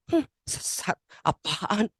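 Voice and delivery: acted disapproving grumble to oneself; grumbling. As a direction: grumble it with a scornful hmm. (gerutuan mencibir)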